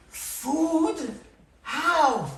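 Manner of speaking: high, amazed questions; astonished questions. Acted. Monster seeing food in the house, high astonished. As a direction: sound stunned by the food.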